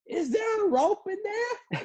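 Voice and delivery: very high, slow mock shout out the window; high shouted question. Lady leaning out her window to the narrator, very high mock shout. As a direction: yell it squeaky from the truck window. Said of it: "she's like".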